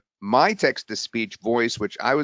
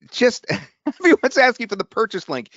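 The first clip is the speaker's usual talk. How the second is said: laughing; 10 semitones higher than usual. Real, not acted. Amused at chat requests.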